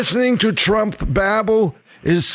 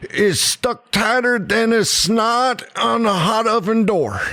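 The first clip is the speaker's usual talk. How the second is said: put-on Southern accent; 4 semitones higher than usual. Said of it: "talk like them Southern folk".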